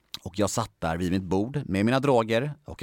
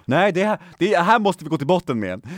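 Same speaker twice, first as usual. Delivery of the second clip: louder, high-pitched retold protest; agitated insistence; 8 semitones higher, 5 dB louder than usual. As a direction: insist urgently, raising pitch and volume. Acts his alarmed insistence in story. (uppjagad och bestämd)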